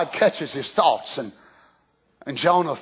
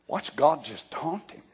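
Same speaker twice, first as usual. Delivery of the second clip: normal talking voice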